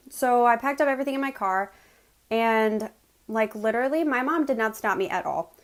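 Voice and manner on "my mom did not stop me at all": usual speaking voice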